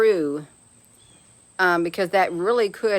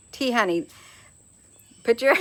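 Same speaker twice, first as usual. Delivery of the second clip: ordinary talk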